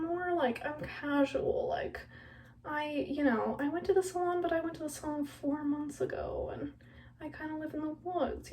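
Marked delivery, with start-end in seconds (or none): 4.6-8.5 whispered aside, half-joking. Real, not acted. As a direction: confide it under your breath. Whispered confession.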